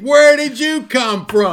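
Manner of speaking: high, loud, slow fake astonishment; fake surprise. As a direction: act amazed to see them. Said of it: "You gotta be some good acting".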